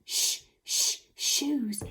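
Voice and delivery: two separate "Shh" sounds, then "shoes"; sounding out sh. Shown as a demonstration. Teacher leading children to join in, "Shh, shh, shoes" sounded out. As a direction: repeat the "Shh" onset before saying "shoes" whole. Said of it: "Can you say that with me?".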